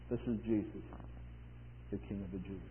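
very soft, low recitation; solemn hush. Retold or imitated. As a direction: drop low and let each phrase rest. Hushed reading of the inscription.

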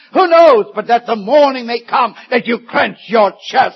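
preaching climax, pitch soaring; rising alarm. Real, not acted. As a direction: climb to a shouted question. Preacher warning of sudden death.

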